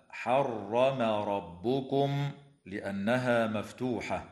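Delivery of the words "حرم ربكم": حرم with a full, heavy, emphatic R drawn out very slowly; heavy raa. Shown as a demonstration. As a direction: say حرم ربكم slowly, each raa thick and rounded. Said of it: "وتفخم الراء في قوله تعالى".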